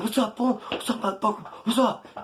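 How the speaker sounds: high, loud mock tough-guy taunt; mocking loud flexing. Imitating Ryan flexing loudly at the narrator, loud mock taunt. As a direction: puff up and taunt like a blowhard. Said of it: "Being real loud, flexing".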